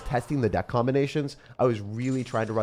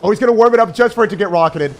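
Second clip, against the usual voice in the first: much higher and louder, gleeful; excited shout; 11 semitones higher, 13 dB louder, faster than usual. Real, not acted. Streamer gleefully yelling as the opponent walks into his rocket. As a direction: yell with delight as the trap springs.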